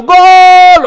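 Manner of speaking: high, loud stadium shout; football goal yell. Retold or imitated. Fan watching football on TV, yelling goal. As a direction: bellow it like a goal on TV. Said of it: "si no está gritando".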